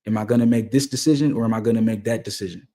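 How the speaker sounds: normal talking voice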